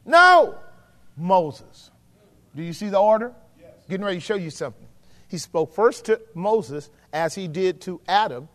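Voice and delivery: ordinary talk, nothing marked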